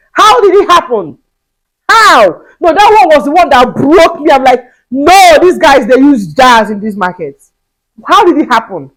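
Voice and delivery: high, loud incredulous questions; outraged disbelief. Real, not acted. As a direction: demand how it could happen again. Reliving second theft in outrage.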